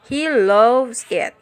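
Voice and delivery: slow, "loves" long and loud; stress on loves. Shown as a demonstration. Teacher modelling an emphasized main verb, stressed "loves". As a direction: punch and stretch "loves". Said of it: "hear how the focus words are emphasized".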